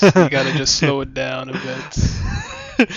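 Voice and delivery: slowed right down, drawn-out words; slow, laid-back talk. Shown as a demonstration. Radio host shows his co-host how to talk after a sexy song, slow and laid back. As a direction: ease off and stretch every word after the song. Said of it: "you gotta talk like this".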